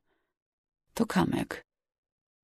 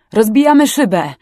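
loud, raised command; urgent shout; 5 semitones higher, 8 dB louder than usual. A narrator's reading. Rescuer announces action at crashed car, urgent shout.

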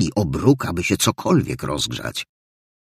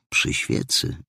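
usual speaking voice

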